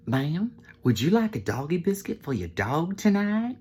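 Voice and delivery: high, over-polite drive-thru server voice; hammed-up polite server; eager server. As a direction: offer it in a high customer-service lilt. Acts own drive-thru offer to lady.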